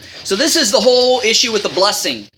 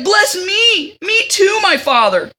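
mock wailing; 11 semitones higher, slower than usual. Esau begs his father again, mock wailing. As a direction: beg like a spoiled brat. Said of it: "So he's like".

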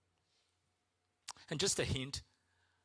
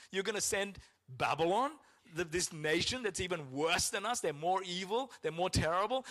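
much higher and louder, disbelieving questions; incredulous Habakkuk; 7 semitones higher, 5 dB louder than usual. Retold or imitated. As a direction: demand an answer in disbelief. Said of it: "And Habakkuk's like".

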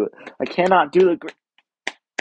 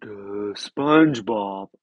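Kronk impression; 5 semitones lower, 3 dB louder, much slower than usual. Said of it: "it's Kronk from Emperor's New Groove".

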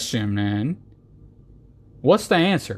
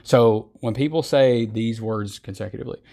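usual speaking voice

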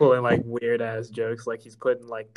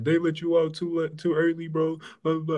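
higher, louder grumbling mom voice; complaining mom; 6 semitones higher, 5 dB louder than usual. Retold or imitated. Mom complains he was released too early, higher grumbling. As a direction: grumble like a fed-up mother. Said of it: "She was mostly complaining".

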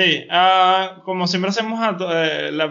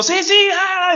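high, loud mock cheering; crowd yelling; 6 dB louder, slower than usual. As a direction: shout along like an angry crowd. Imitating crowd yelling in queues.

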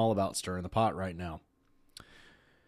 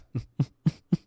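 burst of laughter; 4 semitones higher, 4 dB louder than usual. Real, not acted. Breaks up reading study.

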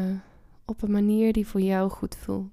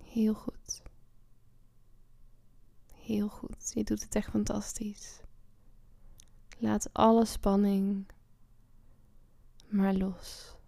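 hushed, gentle whispered praise; soft spa whisper; 4 dB quieter than usual. Acted. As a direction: whisper the encouragement softly. Whispered praise during relaxation.

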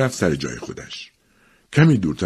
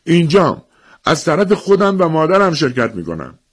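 high, loud answer from the crowd; calling out; 11 semitones higher, 5 dB louder than usual. Acted. Boy answering for himself and his mother, calling out. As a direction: speak up so the official hears.

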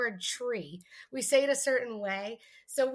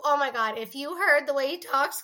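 ordinary talk

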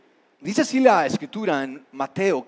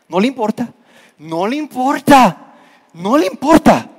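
higher, insistent hurt complaint, getting louder on the repeats; offended disciples' grievance; 6 semitones higher, 3 dB louder than usual. Acted. Offended disciples grumble that Jesus does not care, higher and insistent. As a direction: hammer the repeated no le importa harder each time.

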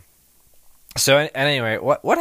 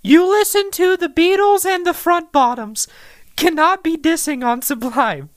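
put-on high girly voice, much higher; man doing a woman's voice; girl voice; 13 semitones higher than usual. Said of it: "That's what a girl sounds like".

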